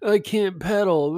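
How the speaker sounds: half-hearted mock whimper about the cold; big baby whine. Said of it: "I'm a big baby about it".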